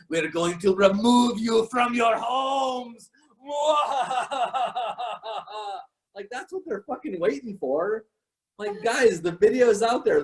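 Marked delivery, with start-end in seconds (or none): 0.1-3.1 slow, high evil villain voice; menacing movie villain; Bond villain. Retold or imitated. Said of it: "like an evil villain in a Bond movie". 3.5-5.8 villainous laugh; evil laugh. Retold or imitated. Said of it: "like an evil villain in a Bond movie".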